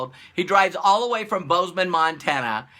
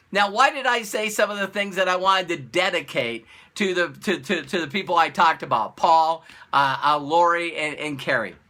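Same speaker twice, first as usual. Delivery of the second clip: ordinary delivery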